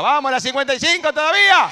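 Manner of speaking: high, loud cheer for the school; grito de aliento. Real, not acted. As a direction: whip up the crowd like a fan. Alienta a la escuela ante el público. (alentando a los gritos, como hinchada)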